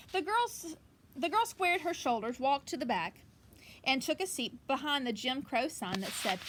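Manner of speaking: lively picture book reading; story reading. Acted. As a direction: read it out to the kids with energy. Reads picture book aloud.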